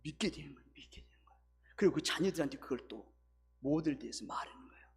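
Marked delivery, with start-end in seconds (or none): 0.0-1.4 breathy near-whisper, hushed. Real, not acted. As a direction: drop to a hushed confidential whisper. Preacher drops to whisper.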